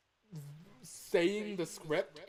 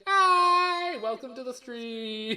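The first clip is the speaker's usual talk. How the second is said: loud greeting; 10 semitones higher, 11 dB louder, much slower than usual. Real, not acted. Greeting stream viewers.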